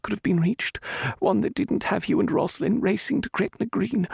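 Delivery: a mother ashamed, holding back tears; grieving older woman Roberta. Acted. Roberta answers through tears.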